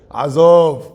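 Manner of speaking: loud, forceful one-word brush-off; very strong dismissal. Shown as a demonstration. Speaker showing how powerful the Hebrew word is, very strong. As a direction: punch it out to show its power. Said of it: "It's very strong when you say that".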